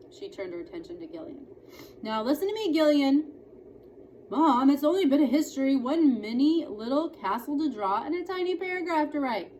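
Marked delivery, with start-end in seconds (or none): 2.0-3.3 stern mother. Acted. Mother scolding son. 4.3-9.5 quick, loud, high protest; pleading boy. Acted. Son pleads with mother over homework, quick and loud.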